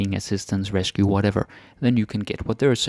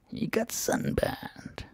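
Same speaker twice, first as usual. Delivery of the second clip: whisper; 13 semitones higher, 9 dB quieter, much slower than usual. Shown as a demonstration. Whispered translation aside.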